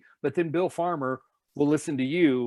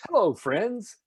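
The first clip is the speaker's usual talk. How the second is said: bright, raised announcer hello; announcer greeting; 6 semitones higher, 6 dB louder, slower than usual. Shown as a demonstration. Park announcer welcoming the crowd, raised and bright. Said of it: "now everything is".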